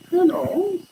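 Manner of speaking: very high, loud sing-song hello to the cat; high cat greeting. Acted. Calling hello to the cat in a very high voice. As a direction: coo it up high at the pet.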